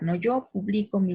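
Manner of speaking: ordinary talk, nothing marked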